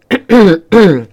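deliberate, loud throat-clear; polite but pointed ahem. A narrator's reading. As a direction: clear your throat to get attention. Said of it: "Homer cleared his throat".

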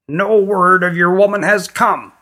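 high, reedy character voice; reedy-voiced queen. A narrator's reading. Queen telling visitors there is no news, high and reedy. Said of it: "in her reedy voice".

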